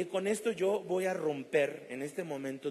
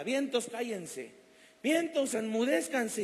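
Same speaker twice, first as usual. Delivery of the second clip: slow, then a much higher, louder cry of vientos; rebuking shout; 7 semitones higher, 3 dB louder, slower than usual. Retold or imitated. Man on the plane shouts at the winds to be silent. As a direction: rebuke the storm with all you have.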